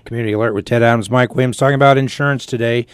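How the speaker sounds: fast, upbeat radio announcer read. Acted. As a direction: announce the break briskly. Radio host announces break.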